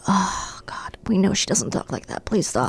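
hushed, low muttered aside; retold private reaction. Mom recalling her silent reaction to another mom, muttered aside. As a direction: mutter it under the breath, exasperated plea. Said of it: "And I'm like".

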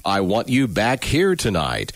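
ordinary talk, nothing marked

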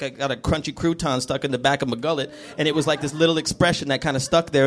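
ordinary delivery

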